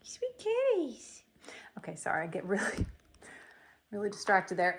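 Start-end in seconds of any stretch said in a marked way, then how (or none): 0.0-1.2 high, slow whisper to the kitties. Real, not acted. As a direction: breathe it softly to the cats. Whispering to the cats.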